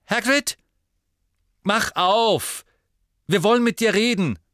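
high, loud call through a door; demanding shout. A narrator's reading. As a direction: shout for him to open up. Harry calls through the shut door.

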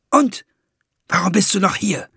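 high, sharp rebuke; snapping at him. A narrator's reading. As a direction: bite the question at the dwarf. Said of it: "fuhr Fuchs ihn an".

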